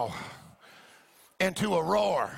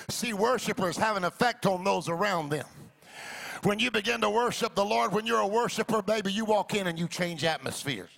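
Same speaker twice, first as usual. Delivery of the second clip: rising, musical sermon over organ; fervent preaching; 4 semitones higher than usual. Real, not acted. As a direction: preach it up to the crowd.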